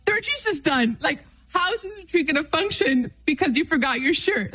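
squeaky, self-mocking meltdown; mock panic. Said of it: "I was like".

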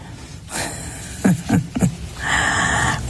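quiet unvoiced chuckle; soft breathy laugh. Real, not acted. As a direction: let out a hushed laugh. Laughs after "come on.

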